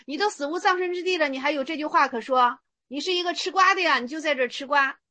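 ordinary delivery

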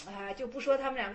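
ordinary talk, nothing marked

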